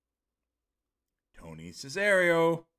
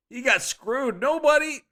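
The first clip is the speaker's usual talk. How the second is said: high, loud shout of "He got screwed"; hyped shout; 11 semitones higher, 8 dB louder than usual. Shown as a demonstration. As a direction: yell it like a ringside fan. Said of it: "Now, going again".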